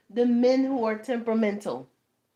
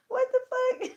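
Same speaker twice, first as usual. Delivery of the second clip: much higher, squeaky disbelief; incredulous; 4 dB louder than usual. Real, not acted. Mother reacting to the guy's attitude, high incredulous squeak. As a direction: exclaim in laughing shock.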